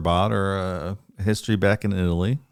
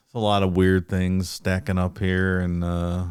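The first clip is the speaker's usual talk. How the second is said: ordinary delivery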